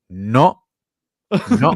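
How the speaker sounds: two curt, separated refusals in imitation; imitated catchphrase no. Imitating the streamer's no for Tom, emphatic. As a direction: mimic his emphatic no, pausing between. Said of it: "I like it when he says".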